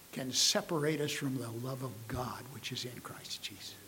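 soft, breathy hush after the shout; whisper. Acted. Pastor closes the verse to his church in a hushed whisper. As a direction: let the verse fall to a whisper.

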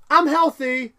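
high, loud cheery insistence that fades down; Zoidberg insisting he's fine. Retold or imitated. Zoidberg reassuring the crew, high and eager. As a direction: chirp that you're healthy, then settle. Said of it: "Zoidberg's like".

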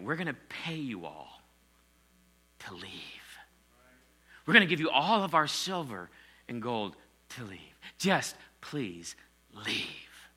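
a lightly voiced beg, trailing to a slow whisper; pleading Egyptians. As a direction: mildly act out a desperate plea, slowing at the end. Egyptians beg Moses to leave.

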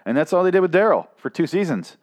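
ordinary talk

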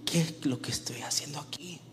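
hushed, bewildered question under the breath; self-questioning whisper. Acted. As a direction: whisper it to yourself. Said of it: "se pregunta".

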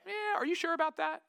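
mock doubtful retort. Preacher voicing Israel's cheeky doubt, mock skeptical. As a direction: scoff at God like a doubting Israelite.

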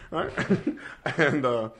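breathy laugh through the words; words lost in a real laugh. Real, not acted. Laughing at his own story.

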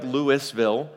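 Louisville said as it is written, LOO-iss-vil; Louisville read off the page like a tourist, "Lewis-ville"; spelling pronunciation. Shown as a demonstration. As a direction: sound the S in Louisville. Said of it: "On paper, it looks like".

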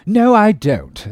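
high, slow, drawn-out denial; indignant captain. Acted. Captain denying he dented the skull, high protest. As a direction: protest it heartily.

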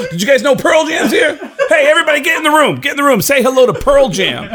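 loud, high, excited shout; boastful announcing. Retold or imitated. Introducing a famous friend backstage at intermission, boastful shouting. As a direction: show off the famous friend to all.

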